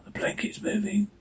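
terrified whisper. Acted. Son warning his father of the moving blanket, whispered. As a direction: breathe it out, frozen in fear. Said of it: "Bud whispers".

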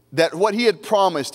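usual speaking voice

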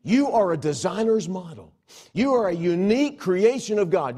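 full-force preaching. Real, not acted.

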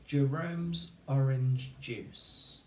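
words spaced out slowly for spelling; slow teacher dictation. Shown as a demonstration. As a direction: dictate each word slowly and clearly. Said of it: "Okay, three words".

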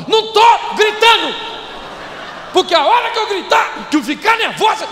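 high, loud furious yell; angry shout. Retold or imitated. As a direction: shout that you're not shouting. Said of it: "Ela tá gritando". (gritando com raiva, bem alto e agudo)

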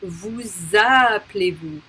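Vous appelez-vous drawn out slowly as "voo-zah-play-voo"; liaison Z. Shown as a demonstration. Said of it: "We need to do the liaison".

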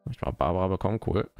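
ordinary talk, nothing marked

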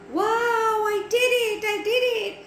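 high-pitched excited boast; thrilled triumph. Acted. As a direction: cheer your own success, voice right up. Max's spell works.

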